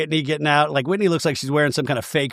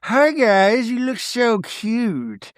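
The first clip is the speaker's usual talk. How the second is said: high, slow sing-song coo; cooing at animals; 7 semitones higher, much slower than usual. Retold or imitated. Meredith cooing at the bison, slow sing-song. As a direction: coo it out slowly like to a baby. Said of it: "she's like".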